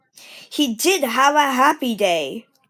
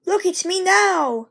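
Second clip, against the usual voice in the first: proud little engine; 8 semitones higher than usual. Acted. Edward proud puffing away.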